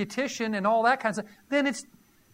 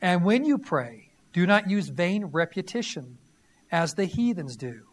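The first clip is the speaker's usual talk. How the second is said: scripture reading; 4 semitones lower than usual. Acted. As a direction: proclaim the verses to the congregation. Reads scripture again.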